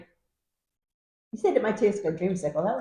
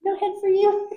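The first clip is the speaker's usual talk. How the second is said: laughing tease; 10 semitones higher than usual. Real, not acted. Teasing, laughing at the beer.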